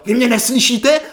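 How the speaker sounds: high, loud indignant shout; imitated yelling doctor. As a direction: yell it as an impatient doctor. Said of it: "jako fakt řve". (napodobený křik podrážděné doktorky)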